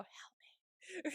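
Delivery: hushed mock whisper breaking into laughter; creepy plea. Host repeating dazed Nomi's plea to co-host, creepy whisper. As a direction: whisper it eerily, half laughing. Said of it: "That was creepy, a little bit".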